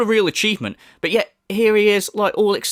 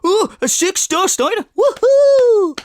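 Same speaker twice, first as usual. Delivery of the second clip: much higher and louder, squealing woohoo; mock giddy cheer; 6 dB louder, slower than usual. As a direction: ham up a fanboy celebrating. Mocking the streamer's excitement.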